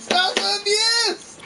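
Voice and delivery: high, loud, drawn-out yell; mock protest. Real, not acted. Protesting a partner's playful abuse, mock yelling. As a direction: cry out slowly as if under attack.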